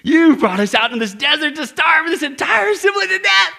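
shouted high and loud, fist-shaking complaint; angry mock shout. Grumbling Israelite shouts at Moses, angry and loud. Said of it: "that's how it comes across to me".

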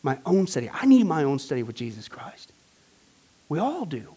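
usual speaking voice

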